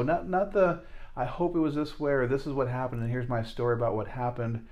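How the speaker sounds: usual speaking voice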